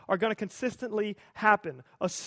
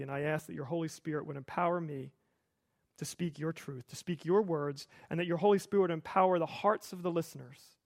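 lower, softer praying voice; hushed, low congregational prayer; public prayer; 4 semitones lower, 4 dB quieter than usual. Real, not acted. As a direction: pray reverently, sinking softer toward the amen. Leads a prayer.

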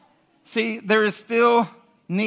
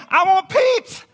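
high, loud pretend clamour; hammed-up group shout; mock crowd demand; 13 semitones higher, 8 dB louder, slower than usual. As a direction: play a crowd yelling for a treat. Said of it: "imagine all of you guys saying".

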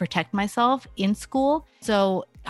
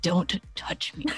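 slow, softer, cold brush-off; irritated parent; 4 dB quieter, slower than usual. Retold or imitated. Parent still upset, refusing child's hug, quietly cold. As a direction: push the hug away with quiet annoyance. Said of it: "you're like".

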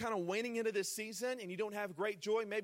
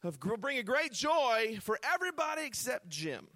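higher, louder, slow-drawn mock proclamation; playful mock angel voice; 5 semitones higher, 6 dB louder, slower than usual. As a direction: ham it up as a teasing angel. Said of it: "he didn't say".

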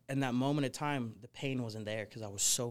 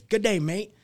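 high, loud Australian greeting, hammed up; exaggerated Australian TV host voice; Steve Irwin Australian accent; 9 semitones higher, 12 dB louder than usual. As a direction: belt it out like an Aussie croc hunter on TV. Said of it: "with an Australian accent".

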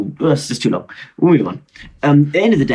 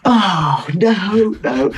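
mock dismay; 8 semitones higher, much slower than usual. Real, not acted. Learns the slave joke was recorded.

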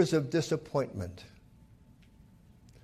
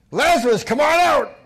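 loud, high call into the tomb; commanding call; 10 semitones higher, 9 dB louder than usual. Acted. Jesus calls dead Lazarus from the tomb, loud high command. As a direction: summon the dead man out.

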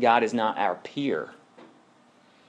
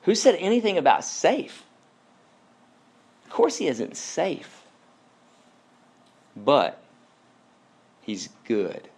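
higher, incredulous beaver voice; lightly voiced gruff old beaver, scoffing then softening; 5 semitones higher than usual. Mr Beaver correcting Lucy about Aslan, mildly scoffing. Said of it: "said Mister Beaver".

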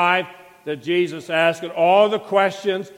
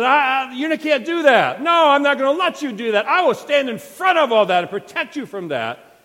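raised, louder pleading protest; protesting outburst; 7 semitones higher, 6 dB louder than usual. Retold or imitated. Preacher voicing Peter's loud protest. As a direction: push back hard like a frantic friend.

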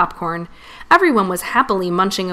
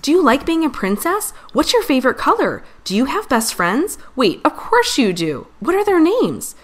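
high, eager string of questions; thrilled chatter, each question lifting high; easily excited; 7 semitones higher than usual. A narrator's reading. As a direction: gush with questions, voice leaping up. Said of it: "Scout gets excited easily".

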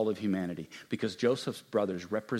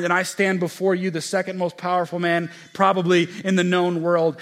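loud, higher preacher's voice; impassioned sermon delivery; preaching at full force; 7 semitones higher, 8 dB louder than usual. Acted. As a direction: preach it out to the hall.

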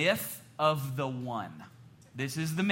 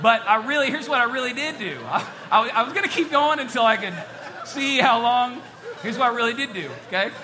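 laughing loud; 7 semitones higher, 7 dB louder than usual. Real, not acted. Laughing after the fake knife story.

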